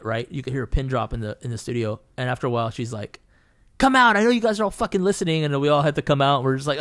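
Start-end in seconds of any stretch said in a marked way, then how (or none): 3.8-5.4 loud, high, fast mock yell; pissed off. Angry painter calling out the eavesdropping studio mates, pissed-off yell. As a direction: imitate an angry demand through closed doors. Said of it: "got all pissed off".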